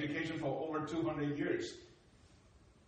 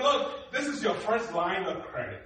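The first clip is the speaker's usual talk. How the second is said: officer explaining; 3 semitones higher, 7 dB louder than usual. Retold or imitated. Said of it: "she said".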